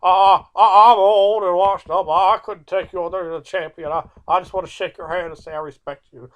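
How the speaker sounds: put-on high, loud, cracking old-timer voice; hammed-up humble old wrestler, fading toward the end; Terry Funk impression. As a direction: play the washed-up old man with a big yelp. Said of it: "He goes".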